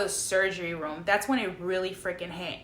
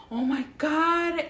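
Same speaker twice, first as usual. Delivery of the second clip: drawn-out, higher-pitched replay of panic; retold freak-out; 7 semitones higher, slower than usual. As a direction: wail it slowly, recalling the fright. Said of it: "I was freaking out".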